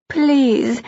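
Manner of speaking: breathed. Acted. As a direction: breathe the word yearningly. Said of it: "she breathed".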